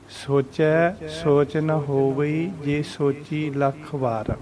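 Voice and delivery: slow recital with drawn-out vowels; measured recitation. Shown as a demonstration. Teacher reciting scripture for learners, slow and measured. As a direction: recite slowly, pausing between phrases. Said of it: "ਵਿਸ਼ਰਾਮਾਂ ਦੇ ਸਹਿਤ".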